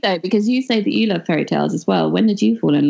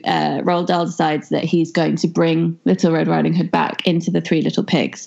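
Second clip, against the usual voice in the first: ordinary talk, nothing marked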